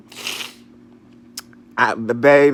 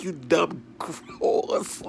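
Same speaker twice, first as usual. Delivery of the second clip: slow whisper of disgust; whispered insult; 4 semitones higher, 4 dB quieter, much slower than usual. Retold or imitated. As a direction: hiss it under your breath, very slowly. Retold insult.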